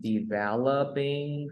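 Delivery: "Developing" drawn out very slowly, syllable by syllable; dictionary word model. Shown as a demonstration. Teacher shows learner a word to search, slow word model. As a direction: stretch "Developing" slowly so each sound is clear. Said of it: "You can search the word that you want".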